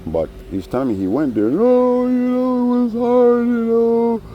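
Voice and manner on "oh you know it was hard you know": very high falsetto whine, much louder; mock whiny grief. Teller mocks a man faking grief, squeaky falsetto whine. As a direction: parody his put-on sorrow in a squeaky high voice. Mocking a guy's fake grief.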